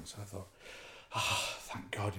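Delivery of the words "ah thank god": slow, soft, exhaled thanks; sigh of relief. Retold or imitated. Stung man promised a remedy, soft slow sigh of relief. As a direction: let the tension out on a long sigh. Thinks help is coming.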